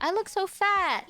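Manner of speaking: whiny complaint. Retold or imitated. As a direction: whine it high and sorry for yourself. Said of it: "I was like".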